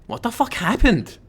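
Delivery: hammed-up horror reaction; mock shocked. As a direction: drag it out like you can't believe it. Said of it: "React like I'm playing Amnesia?".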